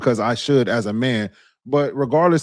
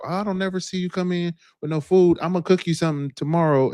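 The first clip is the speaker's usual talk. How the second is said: higher-pitched imitation of a doting coworker; lightly put-on voice, pitched up; mock work wife; 7 semitones higher than usual. Voicing the woman at work.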